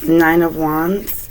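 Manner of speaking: usual speaking voice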